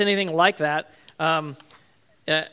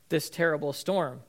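normal talking voice

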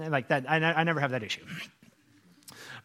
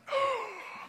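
high imitated gasp; shocked gasp; 6 dB quieter than usual. Cast seeing the sandwich explode, mock shocked gasp. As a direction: mimic a roomful gasping in shock.

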